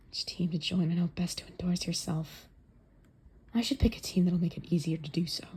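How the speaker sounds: whispering. Acted. Whispered inner monologue.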